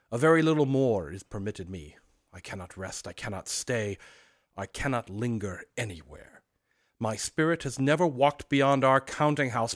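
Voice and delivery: a hint of a solemn ghost; lightly voiced doleful ghost; unearthly voice. Marley's ghost speaks.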